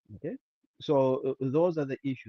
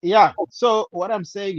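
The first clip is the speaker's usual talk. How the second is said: speaking up; 6 semitones higher, 13 dB louder than usual. Real, not acted. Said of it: "What if you speak up? We can't hear you".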